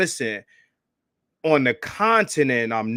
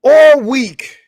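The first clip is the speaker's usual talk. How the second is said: emphatic; 11 semitones higher, 10 dB louder than usual. Real, not acted. Emphatic complaint to chat.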